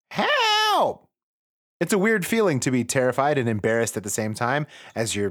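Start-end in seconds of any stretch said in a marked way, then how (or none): 0.1-1.1 even higher, far-off shriek; hammed-up panicked yelp; mock scream. A narrator's reading. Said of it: "you scream".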